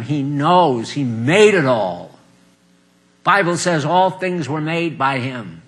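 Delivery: ordinary delivery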